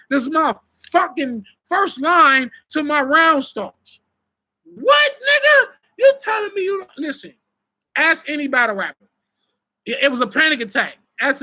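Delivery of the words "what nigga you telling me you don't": shocked what. Retold or imitated. Acting out shocked reaction.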